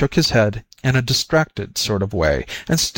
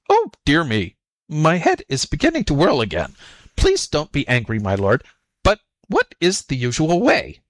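higher, plaintive jackal voice; meekly fussing before the master; flustered jackal; 5 semitones higher than usual. Acted. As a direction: feign dizzy, apologetic bewilderment. Jackal feigns flustered confusion.

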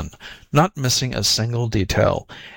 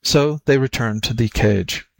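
ordinary delivery

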